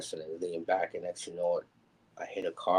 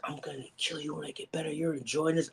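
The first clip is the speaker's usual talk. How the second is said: high, squeezed, hushed threat; pained threat; 9 semitones higher than usual. Retold or imitated. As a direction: squeeze the threat out high and thin through pain. Threat to surgeon while in pain.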